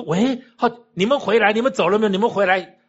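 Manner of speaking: loud, high imitation of an urgent phone call; 急着喊人回来. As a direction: call them back urgently, acting out the caller. Retold urgent phone call calling back. (模仿电话里急切地大声喊人)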